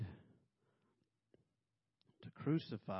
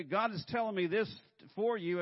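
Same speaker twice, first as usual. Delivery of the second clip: high, louder pious pronouncement; mock preachy messenger; 10 semitones higher, 7 dB louder than usual. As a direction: ham up the holy messenger.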